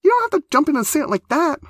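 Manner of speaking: very high, loud and fast; horrified protest. A narrator's reading. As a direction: protest in shocked dismay.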